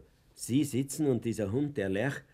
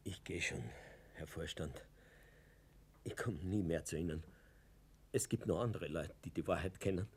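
low, much softer, beaten; defeated and quiet; 8 semitones lower, 10 dB quieter than usual. Acted. Fuchs leaves the director's office, low, soft and defeated. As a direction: go quietly, crushed.

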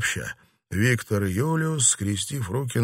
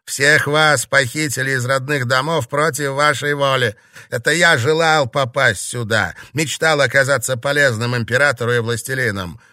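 snide tirade; 4 semitones higher, 6 dB louder than usual. A narrator's reading. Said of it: "Эмиль ехидно улыбнулся".

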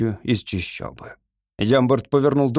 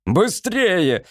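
higher, moderately raised urging cry; urgent shout; 6 semitones higher than usual. A narrator's reading. Lord rushes the gunner after a miss, urgent shout. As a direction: hurry him with a restrained yell. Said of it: "закричал лорд Фейт".

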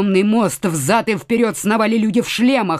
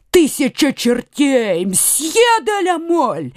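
drawn-out cry, climbing high on the name; stretched, rising call without extra volume; astonished exclamation; 3 semitones higher, slower than usual. A narrator's reading. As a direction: exclaim slowly in amazed recognition. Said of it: "воскликнул Коконас".

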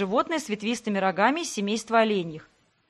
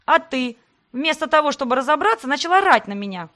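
louder and higher angry snapping; 5 semitones higher, 4 dB louder than usual. Acted. Heroine barks at Sebastian for yelling at her. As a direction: bark it back at him, fully worked up. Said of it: "рявкнула я".

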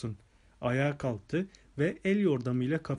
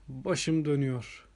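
usual speaking voice

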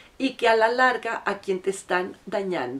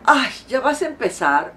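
higher, louder annoyed sigh; fed-up sigh; 6 semitones higher, 7 dB louder than usual. Retold or imitated. Partner brushing off his nagging partner, annoyed. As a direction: sound fed up with her complaints. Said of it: "así como con mucho fastidio".

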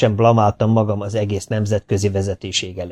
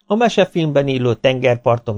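ordinary delivery

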